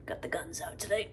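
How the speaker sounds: hushed, breathy flex brag; whispered boast. Pretend. Flexing arms for the camera.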